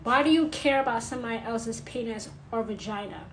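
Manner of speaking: ordinary talk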